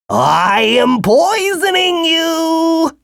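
very high, slow drawn-out monster voice; worm declaring. A narrator's reading. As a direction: announce the poison grandly as the worm. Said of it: "the worm declared shrilly".

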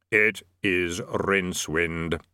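slow, deep, measured Death. A narrator's reading. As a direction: intone it slowly as Death. Death speaks.

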